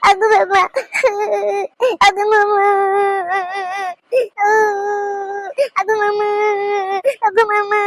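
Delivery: high-pitched tearful wail for uncle; sobbing child calling out; crying child. Acted. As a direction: whimper and cry like an upset little child. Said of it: "இப்படி அழுவாதடி தங்கம்".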